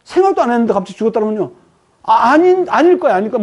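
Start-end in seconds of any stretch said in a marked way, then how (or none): 2.0-3.4 high, louder panicked disbelief with a nervous laugh; stunned wife denying the news, panicky; frantic denial. Acted. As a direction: refuse to believe it, voice jumping up. Hearing husband died.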